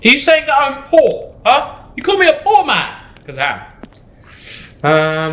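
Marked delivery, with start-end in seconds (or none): 0.1-3.0 high-pitched mock outrage; mock offended. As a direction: play up being insulted, jokingly. Mock offended at friend's joke.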